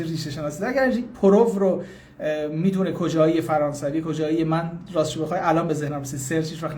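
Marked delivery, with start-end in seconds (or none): none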